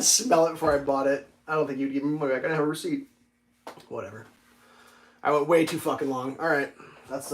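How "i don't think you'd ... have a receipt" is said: ordinary talk, nothing marked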